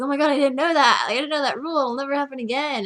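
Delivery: high, hammed-up imitation of his alarm; mock panicked apology. Boy texting back after breaking her rule, mock panicked. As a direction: overplay a frantic excuse.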